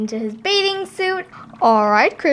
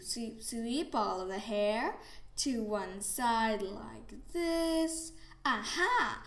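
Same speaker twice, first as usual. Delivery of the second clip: soft storytelling; 15 dB quieter than usual. Acted. Soft voice through haircut scene.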